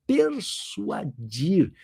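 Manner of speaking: PERSUADIR stretched out slowly; slow, weighty "persuadir"; stress on PERSUADIR. Shown as a demonstration. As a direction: draw out "persuadir" syllable by syllable. Teacher contrasts key term.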